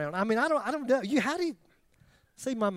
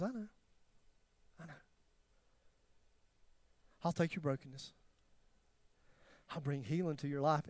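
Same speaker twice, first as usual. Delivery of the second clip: much softer, tender voice of God; 3 semitones lower, 8 dB quieter than usual. Retold or imitated. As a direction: speak love gently to a broken soul. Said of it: "he goes".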